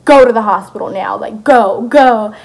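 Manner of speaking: loud, insistent retold command; imitating urgent family telling her off to hospital; urgent order. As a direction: push each "go" out loudly.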